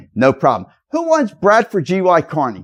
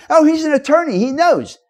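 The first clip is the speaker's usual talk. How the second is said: higher, mock-naive voice; mocking impression; 10 semitones higher than usual. Speaker mocks people who trust an attorney's title, higher mock-naive voice. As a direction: play the gullible believer, pitched up.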